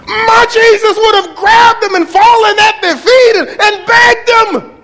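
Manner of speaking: high, loud, wailing mock sob; mock crying outrage. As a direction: wail it in mock disbelief. Mocking preachers' weak Jesus, mock cry.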